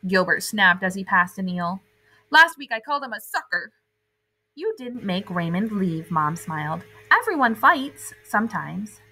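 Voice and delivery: put-on lively read-aloud for kids; animated children's story narration; storybook reading. A narrator's reading. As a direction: read it like a bedtime picture book. Reading picture book to children.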